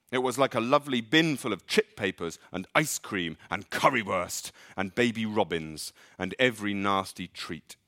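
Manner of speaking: plain reading voice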